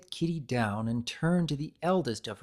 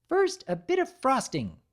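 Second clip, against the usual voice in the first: high, bright sing-song creator; creator's voice; 11 semitones higher than usual. A narrator's reading. Narrator voices the creator decorating cookies, high and sweet.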